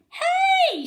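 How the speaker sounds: high, loud call from the stands; shout. A narrator's reading. Fan in the stands calling out, loud and high. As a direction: shout across the stadium. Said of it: "shouted a fan in the stands".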